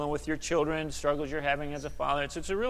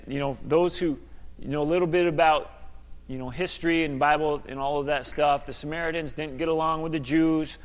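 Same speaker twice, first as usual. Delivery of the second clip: ordinary talk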